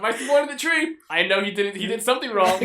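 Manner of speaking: loud and high, laughing; hammed-up excuse; mock defensive parent. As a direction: play a flustered parent for laughs. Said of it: "It's like".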